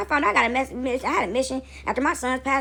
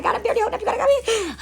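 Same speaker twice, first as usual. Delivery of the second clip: high, hammed-up tearful prayer; pitched-up, laughing mock cry; crying plea; 11 semitones higher, much faster than usual. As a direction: plead to God in a mock sob. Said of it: "some days you in the house crying like".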